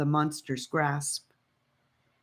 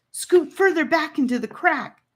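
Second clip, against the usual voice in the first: higher, pressing instruction; urgent urging; 12 semitones higher, 4 dB louder than usual. Acted. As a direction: push them to move now. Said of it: "Gabe urged them".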